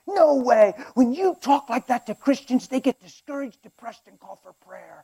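exasperated villain scolding; disbelieving boss. Acted. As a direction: shut down the underling's excuse. Boss argues with the imp.

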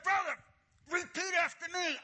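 high, forceful mimicked command; fervent preacher. Pastor instructing the man he grips, fervently. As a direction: demand it in preacher fervor.

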